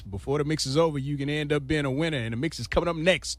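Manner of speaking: punchy radio host sell; upbeat station promo read, hitting the key words. Acted. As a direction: announce a ticket giveaway to listeners.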